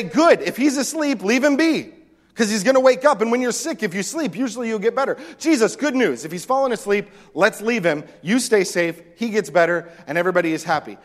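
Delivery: high, loud, eagerly reassuring disciple voice. Retold or imitated. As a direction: play the cheerful, clueless disciples. Disciples voiced, missing the point.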